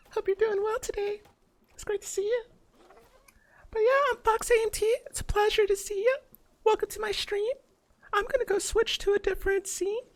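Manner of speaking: put-on very high cutesy e-girl voice; sweet high-pitched streamer girl. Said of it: "E-grill voice".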